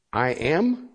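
questioning, with a rise on AM; puzzled disbelief. Retold or imitated. Struggling to accept God's answer to Moses, puzzled disbelief. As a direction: say it as an underwhelmed, doubting question. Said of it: "I always struggled with that".

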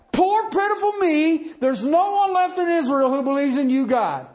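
mock Elijah feeling sorry for himself; whiny self-pity. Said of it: "what does he say".

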